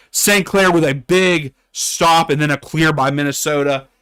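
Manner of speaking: ordinary talk, nothing marked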